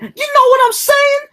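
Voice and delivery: very high-pitched wannabe street rapper; J-Rock catchphrase. Retold or imitated. Host quoting a comedy show character's catchphrase, high wannabe street voice. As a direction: squeal it out like a try-hard rapper. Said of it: "this character named J-Rock".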